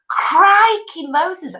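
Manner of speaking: real amazement, drawn out; astonished. Real, not acted. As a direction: gasp it out in disbelief. Shocked by the sack birth.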